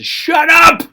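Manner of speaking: sudden loud high yell; crowd's shout. Acted. Crowd telling the blind beggar to stop, shouted. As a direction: burst out to silence someone. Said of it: "all these people that were telling Bartimaeus".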